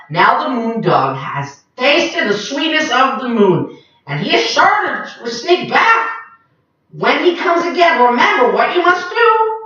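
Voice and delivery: high, earnest parrot warning. Acted. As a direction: caution them seriously. Papagayo warns the creatures.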